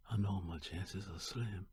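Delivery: usual speaking voice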